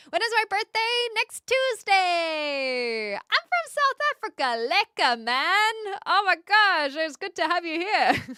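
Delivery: loud, high-pitched excited shout-out. Real, not acted. Streamer welcoming a South African viewer, excited and loud. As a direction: call out with delighted energy.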